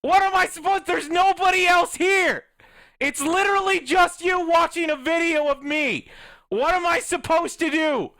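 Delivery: loud, high, exasperated yelling at the viewers; furious high-pitched shout; angry rant. Real, not acted. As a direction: let it all out, shouting at the audience. Ranting at viewers on stream.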